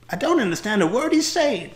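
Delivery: put-on high, loud child's wail; little American girl. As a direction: complain in a piping little-girl voice. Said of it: "little American girl".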